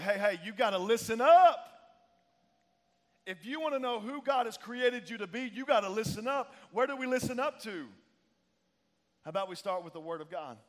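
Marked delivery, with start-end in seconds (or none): 0.0-1.7 raised, higher parental shout; loud call to attention. Acted. Voices a parent demanding attention. 3.3-7.8 higher, driving sermon voice; impassioned pulpit exhortation; fired-up preaching. Real, not acted. Preaches at force.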